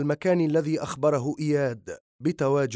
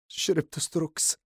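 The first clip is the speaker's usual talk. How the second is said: breaking down crying; 2 semitones higher, 3 dB quieter than usual. A narrator's reading. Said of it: "لينهار من البكاء".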